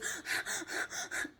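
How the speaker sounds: quick unvoiced panting sigh. Shown as a demonstration. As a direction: show the wrong, panicky breath. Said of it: "some people think deep breathing is".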